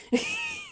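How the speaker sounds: shrill little cackle; high squealing laugh. Real, not acted. Laughs after the voice.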